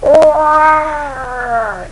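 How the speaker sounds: long, high, loud mock roar; creature roar. A narrator's reading. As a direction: bellow like a wounded beast. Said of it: "goes the creature".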